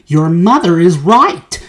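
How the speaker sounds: mild shouting father. A narrator's reading. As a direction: back up your wife, firmly. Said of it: "shouted Mister Beauregard".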